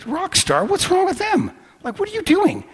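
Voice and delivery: high-pitched, incredulous challenge; retold argument, voice jumping way up; escalating indignation. Driver challenging the ticket guy, escalating indignation. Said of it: "so I escalate him".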